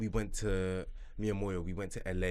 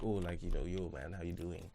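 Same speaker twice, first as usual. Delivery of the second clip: lightly voiced low, soft casual greeting; a hint of a chill celebrity saying hi; [name]'s laid-back greeting; 3 semitones lower, 8 dB quieter than usual. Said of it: "Then he's just like".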